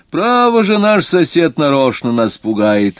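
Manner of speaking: high, loud exclamation; amused exclamation. A narrator's reading. Doctor scoffs at the neighbour's scare, exclaiming. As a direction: exclaim it loudly, pitch leaping up. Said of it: "воскликнул он".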